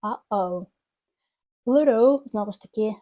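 ordinary talk, nothing marked

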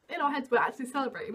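usual speaking voice

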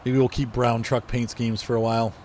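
ordinary talk

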